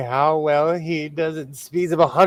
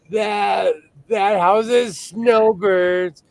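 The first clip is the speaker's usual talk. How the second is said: emphatic outburst; 6 semitones higher, 4 dB louder, much slower than usual. Real, not acted. Rant about Florida snowbirds.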